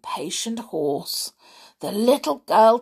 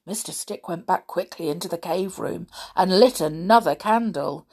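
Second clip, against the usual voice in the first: ordinary delivery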